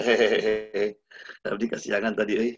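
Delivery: hehehe, shrugging off the oversleeping; mock unrepentant chuckle. Preacher acting a sinner who laughs off missing dawn, chuckling. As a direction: giggle as if getting away with it.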